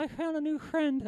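high, soft ventriloquist's puppet. Acted. Puppet tells his ventriloquist of a friend, high puppet voice. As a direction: pipe up as a little puppet.

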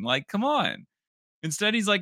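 ordinary talk

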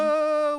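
long, high, loud "oooh"; drawn-out mock ghost wail; ghostly wail. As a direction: wail like a spook making an entrance. Comic ghost announces his arrival.

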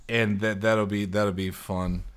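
tipsy, trailing soft. Real, not acted. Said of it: "I shouldn't have drank that much".

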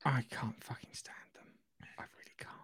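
whispered aside, almost silent; breathy secret whisper. Real, not acted. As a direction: drop to a whisper to confide. Confiding aside about the royals.

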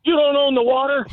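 high-pitched comeback at another boat; retold retort, high but not shouted; mock kid's retort. Kid retorts in shouted quarrel.

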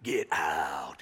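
very low, drawn-out mock growl; hammed-up ominous dismissal; joking menace. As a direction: growl it out as a joke. Joke version of Jesus dismissing crowd.

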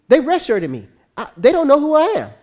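high, loud affronted protest; indignant. Acted. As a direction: play an offended young star. Player indignant at being redshirted.

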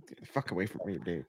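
low, hushed mock rejection; muttered mock recoil. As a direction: say it low and under your breath, as if dodging a hug. Acting out a recoiling hug.

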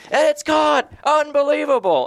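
high-pitched, excited play-by-play shout; yelling announcers. Retold or imitated. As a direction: mimic announcers losing it over a catch. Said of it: "the announcers are yelling".

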